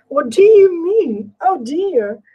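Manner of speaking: usual speaking voice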